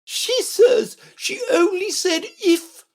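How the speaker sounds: very high, slow imperious repeat; shuddering disdain. A narrator's reading. Red Queen reporting Alice's excuse, shuddering disdain. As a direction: repeat it slowly in a piercingly high voice. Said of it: "remarked with a little shudder".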